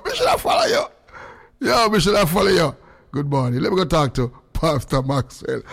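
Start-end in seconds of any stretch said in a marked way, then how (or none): 0.0-1.7 high, slow laughing shout. Real, not acted.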